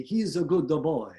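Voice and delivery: cartoonish Italian with an extra vowel pulse; Italian accent. Shown as a demonstration. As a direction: add little vowels between words, Italian-style. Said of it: "in Italian accent".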